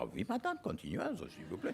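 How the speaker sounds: put-on Poirot invites the old lady to go on, French accent. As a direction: answer politely as Poirot in his French accent. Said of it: "I said".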